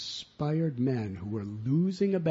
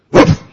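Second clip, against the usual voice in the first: much louder single barked woof; big loud woof; 23 dB louder than usual. Acted. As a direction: bark it out hard. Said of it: "he goes".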